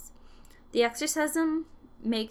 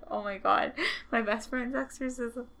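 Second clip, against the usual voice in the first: ordinary talk, nothing marked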